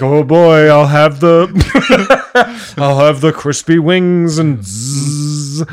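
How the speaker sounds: high, giggly eager voice; a goofy guy ordering wings; excited dopey customer. Retold or imitated.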